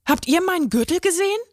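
high, fast and agitated question; excited. A narrator's reading. Rabbit Bono asking the deer about his lost belt, high and fast in agitation. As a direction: blurt it out in a flustered rush. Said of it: "fragte aufgeregt".

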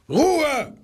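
high shout for silence. Acted. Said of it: "schrie der kleine Zoowärter". (der Zoowärter schreit die streitenden Tiere an: laut und hoch)

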